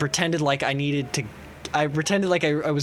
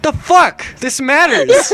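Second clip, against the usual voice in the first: high, loud mock outrage; indignant; 9 semitones higher, 8 dB louder, slower than usual. Student demanding his friend care, indignant mock outrage. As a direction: insist on it, loud and pitched up.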